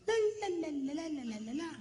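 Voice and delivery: high babble sliding down and fading; mock chattering, tapering off; imitating receding chatter. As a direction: mimic chatter moving away. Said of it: "walks a little bit further away".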